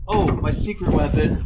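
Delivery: usual speaking voice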